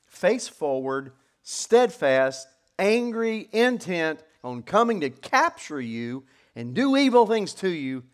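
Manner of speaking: dramatic pulpit description; forceful preaching. Shown as a demonstration. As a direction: paint the advancing army for the congregation. Paints the army with preaching force.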